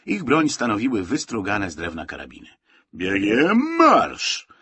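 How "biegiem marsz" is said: loud, high, drawn-out military order; drill command. A narrator's reading. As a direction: bellow it like a colonel leading drill. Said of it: "wydał komendę prowadzący musztrę". (głośna komenda wojskowa)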